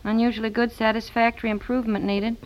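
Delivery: ordinary talk, nothing marked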